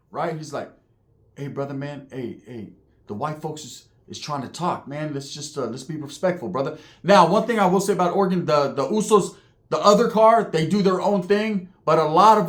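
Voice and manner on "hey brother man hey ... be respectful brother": mellow. Retold or imitated. Big Uso asks the tier for respect, hushed and mellow. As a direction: speak softly to keep the peace. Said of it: "Hella, hella mellow".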